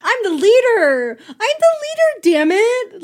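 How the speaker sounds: high, loud petulant insistence; whine-yell it like a hammed-up thwarted leader; mock indignant yell. Mocking Cyclops insisting on his authority.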